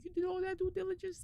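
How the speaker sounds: high-pitched incredulous question; acted disbelief. Employee to boss about the investigation, acted disbelief. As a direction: squeak it out as if amazed.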